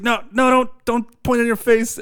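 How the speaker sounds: high-pitched panicky warning, imitated; mock alarm. Host retelling Chuck's safety scene, mock alarm. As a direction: mimic someone warning in a fluster.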